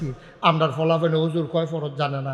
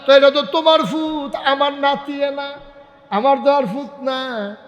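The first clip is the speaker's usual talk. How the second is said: high, plaintive protest; 9 semitones higher, 5 dB louder than usual. Acted. Grandfather comparing his son and grandson, high plaintive protest.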